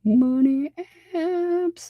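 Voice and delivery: very high, squeaky put-on voice; silly falsetto. Dad goofing about the money app, high silly voice.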